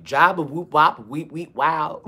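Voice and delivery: nonsense imitation of fake foreign speech; mock gibberish. Sisters replaying what the guy said, mocking it as babble. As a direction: babble silly sounds as if they were a language. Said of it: "everything he was saying".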